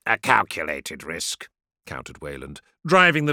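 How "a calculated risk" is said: low, controlled pilot's voice; deep, unruffled retort; cool counter. Acted. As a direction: answer coolly, pitched well down. Pilot coolly counters commander.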